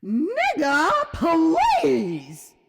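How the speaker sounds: loud, high, sing-song dismissal with a crescendo; sassy put-down. Retold or imitated. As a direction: deliver it like Cherie's song-like comeback. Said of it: "with just the right inflection and crescendo".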